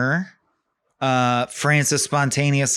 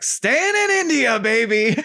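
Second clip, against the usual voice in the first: a whooping cheer as the brothers choose to stay; excited whoop; 11 semitones higher than usual. Retold or imitated. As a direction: belt it out like a giddy tourist. Brothers excitedly turn back.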